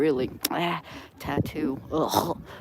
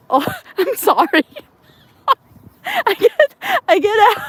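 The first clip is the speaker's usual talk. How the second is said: real laughter, high and loud; laughing sorry, embarrassed at walking into a photo; laughing apology; 8 dB louder than usual. Real, not acted. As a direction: apologize through helpless giggles. Caught in someone's photo.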